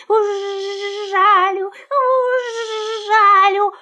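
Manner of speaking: high voice with long buzzing zh; buzzing angry bee. A narrator's reading. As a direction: buzz the threat, stretching the zh. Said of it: "летит и жужжит".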